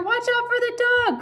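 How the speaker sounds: very high warning call; sheep crying out. A narrator's reading. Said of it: "cried the sheep".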